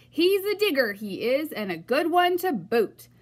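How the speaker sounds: loud pirate call across the beach; pirate yell. A narrator's reading. Pirate captain yelling back to his crew, loud. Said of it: "then yelled back to his crew".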